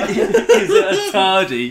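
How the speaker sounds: very high, slow, loud mimicry; squealed mocking repeat, laughing; teasing mock echo. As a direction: drag it out through laughter. Teasing the mispronounced TARDIS.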